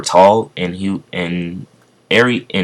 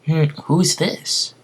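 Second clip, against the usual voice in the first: curious; 10 semitones higher, 3 dB quieter, slower than usual. Acted. Deku curious.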